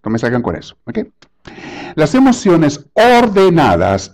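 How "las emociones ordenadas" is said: "ordenadas" slow, high and drawn out; stress on ORDENADAS. Real, not acted. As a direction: spell out "ordenadas" syllable by syllable. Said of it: "aquí resalto la palabra ordenadas". (resaltar "ordenadas", lento y agudo)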